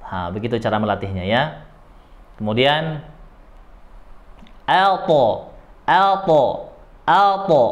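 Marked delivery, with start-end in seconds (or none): 4.7-7.6 alto with a thick, dark al; heavy alto. Shown as a demonstration. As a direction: drill alto slowly with tongue root raised. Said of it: "Kalau dinaikkan jadi al". (alto dibaca tebal)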